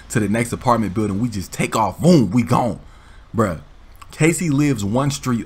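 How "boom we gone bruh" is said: ordinary talk, nothing marked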